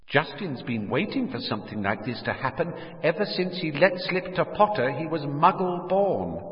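high, distant voice with echo; accusing words ringing in the memory; echoing remembered voice. Acted. Remembered words replay in his head.

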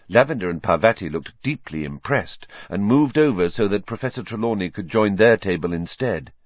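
ordinary delivery, nothing marked

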